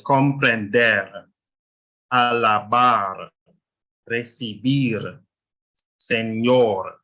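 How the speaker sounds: each word slow, last syllable stressed: comprender, alabar, recibir, señor; stress on final syllable. Shown as a demonstration. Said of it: "you stress the final syllable".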